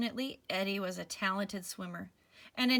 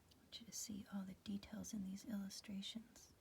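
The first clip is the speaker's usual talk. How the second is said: whispering; 15 dB quieter, faster than usual. Real, not acted. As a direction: whisper it gently. Whispered aside.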